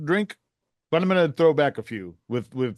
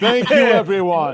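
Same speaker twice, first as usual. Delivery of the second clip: high, loud thanks through laughter; laughing thanks; 6 semitones higher, 7 dB louder than usual. Acted. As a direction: laugh out a giddy thank you to the crowd. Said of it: "I'm like".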